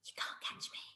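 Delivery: a teasing breathy whisper. Acted. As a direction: whisper it like a playful dare. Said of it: "and whispered".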